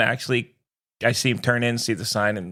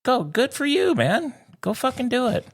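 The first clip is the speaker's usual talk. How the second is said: high mock-cheerful brush-off; sarcastic go-for-it, pitched up high; 13 semitones higher than usual. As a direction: cheer them on, dripping with sarcasm.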